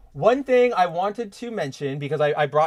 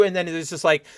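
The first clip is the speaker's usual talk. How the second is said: ordinary talk, nothing marked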